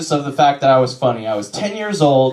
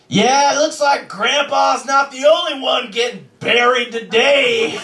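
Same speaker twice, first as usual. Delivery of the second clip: put-on kid's yell; 11 semitones higher than usual. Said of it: "has to yell out".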